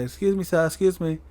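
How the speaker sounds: lightly mimicked fan's call; imitated attention-seeking. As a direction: mimic someone trying to get noticed. Acting out fan seeking attention.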